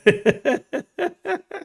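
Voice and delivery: breathy burst of laughter; soft real laughter. Real, not acted. Laughing at own chat joke.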